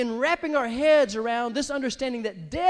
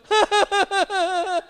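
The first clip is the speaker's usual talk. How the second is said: high, loud forced laugh; quick string of forced ha-has; fake laugh; 7 semitones higher, 7 dB louder than usual. Shown as a demonstration. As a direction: demonstrate a put-on ha-ha-ha. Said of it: "you see me get on there and go".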